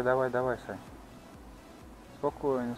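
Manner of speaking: usual speaking voice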